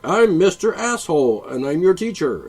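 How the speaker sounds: loud, high-handed teacher introduction; mock bossy teacher. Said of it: "there's a teacher comes in".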